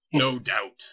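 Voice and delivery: slow, loud gloating villain. Acted. Dark lord taunts the heroes, slow and loud gloating. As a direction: savour each word like a smug tyrant.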